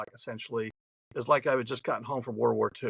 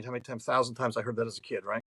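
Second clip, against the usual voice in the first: normal talking voice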